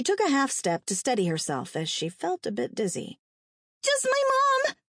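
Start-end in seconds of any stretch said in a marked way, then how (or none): 3.8-4.8 put-on very high child's voice; small boy, pitched way up; boy answering. A narrator's reading. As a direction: answer as a frightened little boy. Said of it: "Terry answered".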